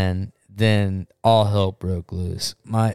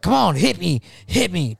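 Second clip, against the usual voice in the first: higher-pitched taunting dare; retold goading; 7 semitones higher than usual. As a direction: act out a brother begging for a fight. Brother goading a man acted out.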